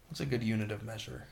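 usual speaking voice

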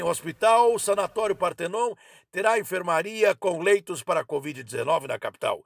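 usual speaking voice

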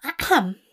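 polite spoken "ahem"; pointed little throat-clear to break silence. Acted. Angel breaking a heavy silence, clearing his throat. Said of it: "clears his throat".

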